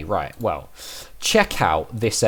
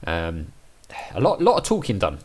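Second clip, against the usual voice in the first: ordinary talk, nothing marked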